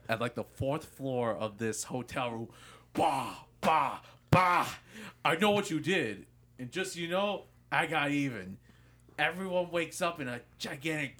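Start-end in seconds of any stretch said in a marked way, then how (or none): 2.9-4.7 loud banging noises, mouth-made; mock door pounding. Imitated door banging at night. 5.2-8.6 loud, higher threatening call; mock threat shouted from the hallway; retold shout. Prank victim shouting through door.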